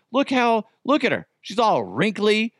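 high-pitched, insistent mock complaint; grilling daughter. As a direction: imitate an indignant daughter pressing her case. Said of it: "like, grilling me".

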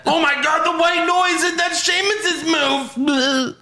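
very high, squealing mock commentary; gushing in a strained high voice; overexcited commentator. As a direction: parody a wrestling announcer losing it with excitement. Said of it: "Michael Cole creams his jeans".

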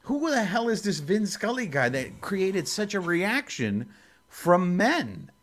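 imitating baffled partners, pitched high; perplexed. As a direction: ask it like someone utterly puzzled. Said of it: "they were very perplexed".

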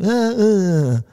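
high careless yes-yes; mock boy. Boy brushing off his dad's warning, high and careless. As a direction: brush off dad like a teen.

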